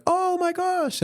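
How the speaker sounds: high, startled squeal imitating the passenger; squealed oh my gosh. Driver imitating the embarrassed passenger, high surprised squeal. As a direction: mimic her shocked little squeal at the wrong car. Passenger realizes wrong car.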